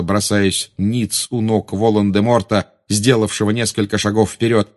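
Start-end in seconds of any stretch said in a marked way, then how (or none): none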